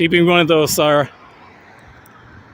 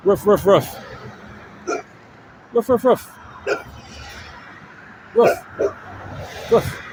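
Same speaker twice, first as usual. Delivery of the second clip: playful barking imitation, pitched up; dog barks; 4 semitones higher, 4 dB quieter than usual. Vlogger barks back at the passing dog. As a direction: bark like a friendly dog.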